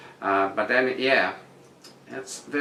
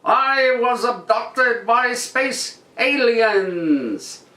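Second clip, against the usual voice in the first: mock dramatic headline; 12 semitones higher, 5 dB louder, much slower than usual. Reading out a tabloid headline.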